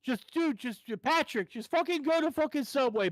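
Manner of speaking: high, exasperated retold outburst; exasperated pleading. Fed-up passenger telling driver Patrick to leave, mock exasperation.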